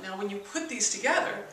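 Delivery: ordinary talk, nothing marked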